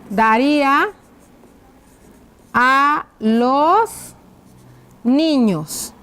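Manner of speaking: drawn-out words, pauses between; writing-out dictation. Shown as a demonstration. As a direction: dictate slowly as you write. Dictates while writing the question out. (dictado lento, con pausas)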